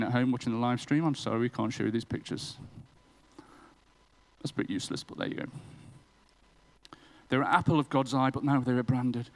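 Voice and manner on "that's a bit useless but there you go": unvoiced, hushed aside to the online viewers; muttered aside. Real, not acted. Preacher whispering an aside to livestream viewers. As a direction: drop to a breathy whisper for the joke.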